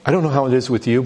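usual speaking voice

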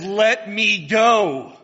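loud, very slow command. Acted. Angel ordering Jacob, loud and slow. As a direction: draw out each word with authority.

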